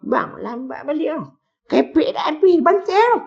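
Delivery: a wife whispering to her husband in the kitchen, high and hushed; wife complaining to husband. Retold or imitated. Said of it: "cakap dengan lelaki".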